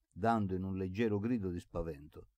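usual speaking voice

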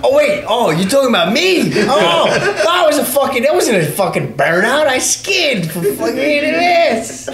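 hammed-up outrage, pitch leaping up; mock indignation. Biker denies doing a burnout, mock-indignant and high. Acts out his indignant comeback.

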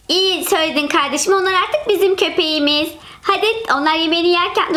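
normal talking voice

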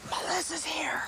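hushed stage whisper. Acted. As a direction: whisper it so she can't hear. Sneaky aside about guest.

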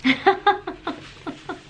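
laughing through the words; high, breaking into laughter. Real, not acted. Laughs tasting expired cracker.

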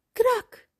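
short high "krak"; creaking step sound. A narrator's reading. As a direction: imitate a stair cracking, high and abrupt. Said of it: "doet een tree".

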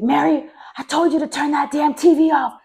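retold furious telling-off; pissed-off mother. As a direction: imitate an angry mother yelling an order. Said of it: "she got so pissed".

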